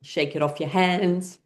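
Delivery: usual speaking voice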